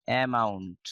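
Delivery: অ্যামাউন্ট in full, "e-ma-unt"; local pronunciation. Shown as a demonstration. Teacher showing how we say it, full "e-ma-unt". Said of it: "শেষে এন উচ্চারণ করি".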